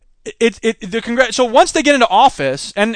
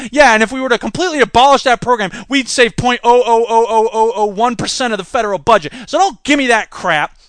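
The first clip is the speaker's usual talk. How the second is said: ordinary talk, nothing marked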